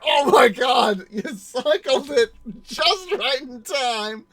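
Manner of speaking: winded exclamation. Retold or imitated. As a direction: react to a blow to the stomach. Retelling being winded.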